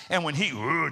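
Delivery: usual speaking voice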